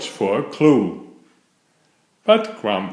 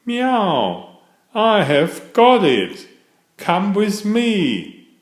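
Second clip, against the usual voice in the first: much higher, thrilled cat detective; excited discovery; 9 semitones higher, slower than usual. Acted. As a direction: announce the breakthrough with glee. Detective cracks the case.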